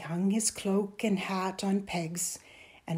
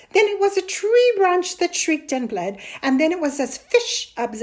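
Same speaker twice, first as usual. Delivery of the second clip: loud, heated list of complaints; exasperated scolding; 6 semitones higher, 11 dB louder than usual. A narrator's reading. Mother lists her son's lies, exasperated. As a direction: reel off each tall tale in exasperation.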